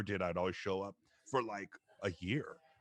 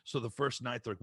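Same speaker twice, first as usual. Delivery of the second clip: ordinary delivery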